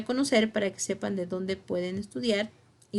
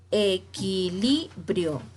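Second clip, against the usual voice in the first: "equilibrio" very slowly as e, qui, li, brio; split into syllables; much slower than usual. Shown as a demonstration. Teacher giving an example of grave words, "equilibrio" syllable by syllable. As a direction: separate each syllable of "equilibrio".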